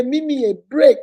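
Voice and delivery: mother mimicking her little girl's plea; imitating little daughter. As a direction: whine it like a small child. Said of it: "She say".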